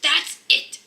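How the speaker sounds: loud, high shout of "That's it!". A narrator's reading. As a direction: lose patience and shout. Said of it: "shouted Mister Bear".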